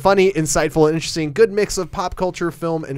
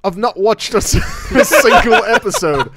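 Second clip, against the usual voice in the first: put-on British accent; 2 semitones higher, 3 dB louder than usual. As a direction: try a posh British voice, badly. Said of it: "Can you finish this in a British accent?".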